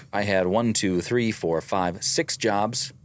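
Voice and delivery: usual speaking voice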